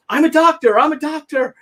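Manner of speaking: high, urgent announcing; urgent doctor. Retold or imitated. Doctor arriving at injured man, urgent high call. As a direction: step in with authority.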